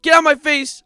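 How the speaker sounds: high, loud mock yell; mock shouted get out. Streamer yelling a meme line at chat. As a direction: throw it at them loud.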